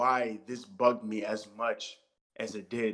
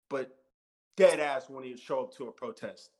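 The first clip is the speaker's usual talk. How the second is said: ordinary talk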